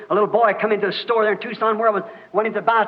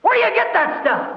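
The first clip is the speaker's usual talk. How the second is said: high-pitched exasperated question; exasperated preacher, pitch jumping up; indignant exclamation; 7 semitones higher than usual. Real, not acted. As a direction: demand an answer in disbelief.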